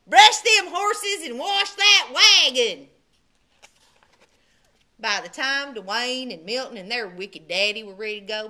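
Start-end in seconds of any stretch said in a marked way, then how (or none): 0.1-2.9 loud, slow mean commands; shouting. Acted. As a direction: bark orders like a wicked stepdad. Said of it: "shouted his wicked stepdaddy".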